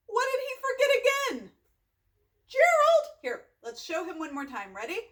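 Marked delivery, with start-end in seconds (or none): 0.1-3.0 high, exasperated questioning; mock scolding. Reader asks children what Gerald forgot, mock scolding. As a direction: call him out in mock dismay.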